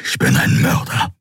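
a lightly voiced gruff beast, grave; a hint of a brooding creature; Skria Keru. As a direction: confess it heavily, like a guilty giant. Keru's voice in dialogue.

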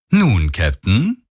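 drawn-out, falsely friendly greeting, rising at the end; slow, deceptively pleasant question. A narrator's reading. Voice over the comm toying with the captain, falsely friendly. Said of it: "ertönte die trügerisch freundliche Stimme".

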